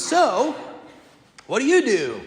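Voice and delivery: a light send-up of the eager seatmate; imitating chatty stranger. Priest imitating the man on the plane, bright and loud. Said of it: "and he goes".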